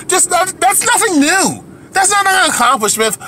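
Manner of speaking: high-pitched exasperated rant; indignant outburst. Real, not acted. Ranting, voice jumps up high.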